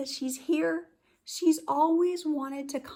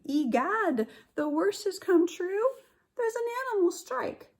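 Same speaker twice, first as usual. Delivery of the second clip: higher, louder, mock dismay; dramatic alarm; 9 semitones higher, 5 dB louder than usual. Teacher hamming up the zoo disaster for her class. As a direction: ham up the horror. Dramatic read-aloud climax.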